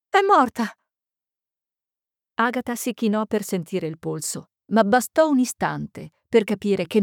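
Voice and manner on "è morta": high-pitched cry of shock; distressed cry. Acted. Young librarian cries out over the body, distressed. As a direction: exclaim it high, in dismay. Librarian repeats that she's dead.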